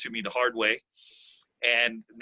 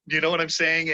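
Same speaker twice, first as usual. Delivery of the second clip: ordinary delivery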